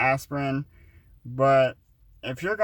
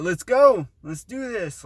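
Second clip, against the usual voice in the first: eager; 8 semitones higher, 7 dB louder than usual. Retold or imitated. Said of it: "I was like".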